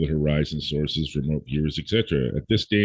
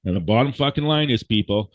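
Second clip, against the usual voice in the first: ordinary talk, nothing marked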